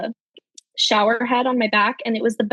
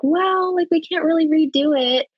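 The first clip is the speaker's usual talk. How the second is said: pitched-up, lightly apologetic staff voice; high apologetic nurse; 7 semitones higher than usual. Retold or imitated. Nurses telling the mother they can't redo it, apologetic. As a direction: shrug it off like a nurse.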